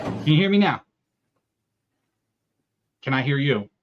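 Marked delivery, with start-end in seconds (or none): 0.0-0.8 loud mic check; shouting. Real, not acted. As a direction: raise the voice to be heard. Mic check, shouted second try.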